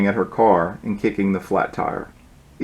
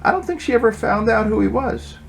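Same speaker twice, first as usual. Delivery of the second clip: high, put-on cooing voice; Cindy gossips about Rocky's date, cooing up high; 9 semitones higher than usual. A narrator's reading. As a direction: coo it sweetly up high. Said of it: "cooed Cindy".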